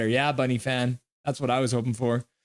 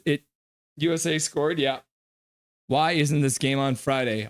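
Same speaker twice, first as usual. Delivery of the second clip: normal talking voice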